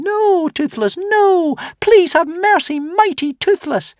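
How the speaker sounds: very high, loud squeals for mercy; tiny dragons begging Toothless for mercy; squealing little dragons. Retold or imitated. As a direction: squeal in mock terror.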